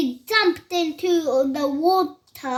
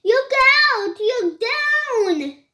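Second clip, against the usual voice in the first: higher-pitched warning call; mild yell; 8 semitones higher, 4 dB louder than usual. A narrator's reading. Drip warns his sisters on the bridge, yelling. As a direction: call the warning high, not very loud. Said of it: "who yelled".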